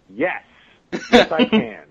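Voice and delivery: loud, high, eager yes. Retold or imitated. Actor retelling his answer to the producer, eager and loud. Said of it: "I'm like".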